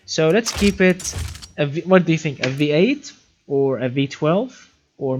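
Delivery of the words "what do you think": ordinary delivery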